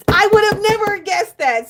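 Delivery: high, loud astonished cry; genuinely floored by the answer; amazed. Real, not acted. Amazed reaction to the joke answer.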